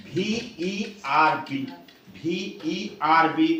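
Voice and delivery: ভিইআরবি spelled letter by letter, very slowly; slow spelling. Shown as a demonstration. Teacher slowly spells the word for the class. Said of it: "British English উচ্চারণে".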